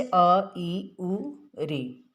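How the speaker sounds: अ, ई, उ, री each said as a brief single beat; short vowels. Shown as a demonstration. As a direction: list अ, ई, उ, री with short separate sounds. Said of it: "एक ही मात्रा के उच्चारण का समय".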